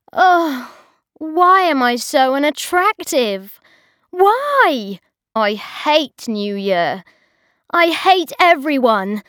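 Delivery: a high, whiny wail; mock despair. A narrator's reading. Bridget alone at two AM, whining in mock despair. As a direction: moan dramatically to yourself. Lonely 2 AM lament.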